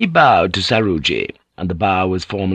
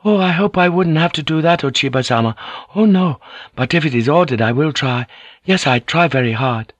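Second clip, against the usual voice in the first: put-on higher, eager, bashful boy; a nervous teenager trying hard; shy young boy; 6 semitones higher than usual. A narrator's reading. Shy boy Saruji answers.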